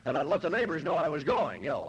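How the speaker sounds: ordinary delivery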